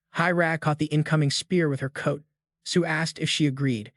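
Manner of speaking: ordinary delivery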